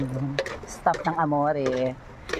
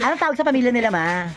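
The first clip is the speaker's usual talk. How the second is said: ordinary delivery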